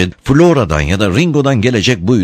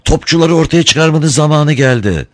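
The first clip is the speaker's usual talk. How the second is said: higher-pitched rallying order; commanding; 8 semitones higher than usual. A narrator's reading. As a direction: give the troops their orders. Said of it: "diye buyruk verdi".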